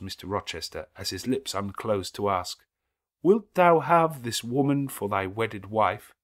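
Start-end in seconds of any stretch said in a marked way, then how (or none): 3.2-6.1 slow, louder priestly question; clergyman's vow. A narrator's reading. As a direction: ask it solemnly, slowly.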